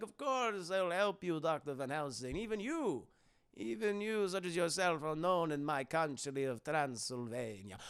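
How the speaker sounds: put-on soft, suave vampire accent; Dracula. Dracula offers Van Helsing help, soft vampire voice. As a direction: purr it like a courteous count. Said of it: "He's like".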